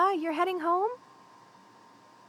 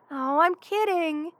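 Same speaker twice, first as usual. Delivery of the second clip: ordinary talk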